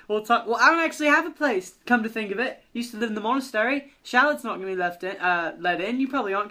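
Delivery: rambling British character, second-guessing himself; British accent. Acted. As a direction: think aloud as a chatty Brit. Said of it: "Mallard and Shallard will have British accents".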